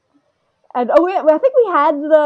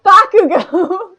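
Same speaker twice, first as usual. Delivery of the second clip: loud, drawn-out name through laughter; higher, slow and laughing; laughing correction; 10 semitones higher, 6 dB louder, much slower than usual. Real, not acted. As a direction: blurt it out, cracking up.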